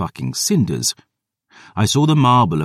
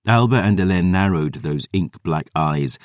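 plain reading voice

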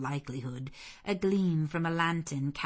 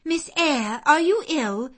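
high, louder, startled worry; alarmed nursemaid; 10 semitones higher, 10 dB louder than usual. Acted. Bessie bursting in on the frightened child, alarmed. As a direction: rush in, anxious for the girl. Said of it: "said Bessie".

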